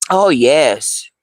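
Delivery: normal talking voice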